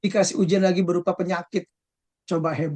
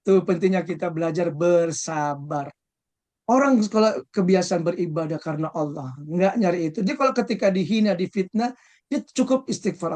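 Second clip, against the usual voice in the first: ordinary talk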